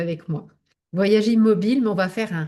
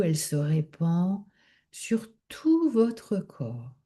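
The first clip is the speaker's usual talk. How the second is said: calm, measured meditation guidance, drawing out key words; guided relaxation. Acted. Leads yoga nidra relaxation.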